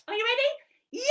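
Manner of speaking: high squeaky monkey asking; Monkey puppet. Acted. Monkey puppet checking the children are ready, high. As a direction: bounce it up as the puppet.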